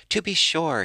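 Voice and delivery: high, loud burst of surprise; surprised exclamation. A narrator's reading. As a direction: blurt it out, caught off guard. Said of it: "he exclaimed".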